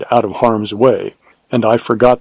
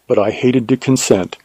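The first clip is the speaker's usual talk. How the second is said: usual speaking voice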